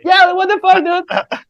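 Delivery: high-pitched imitated disbelief; mock outraged protest. Band reacting to the champagne prank, mock outrage. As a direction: squeal it as an imitation of their indignation.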